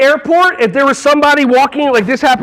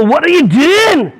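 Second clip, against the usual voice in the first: higher, slow mock-Scottish call; Scottish accent; 4 semitones higher, slower than usual. Shown as a demonstration. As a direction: grab the class with a put-on Scottish accent. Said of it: "Scottish accents".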